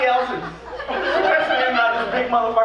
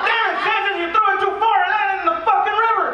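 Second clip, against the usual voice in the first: high, louder mock yell; 11 semitones higher, 3 dB louder than usual. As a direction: scold him like an angry soldier. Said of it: "somebody yells at me".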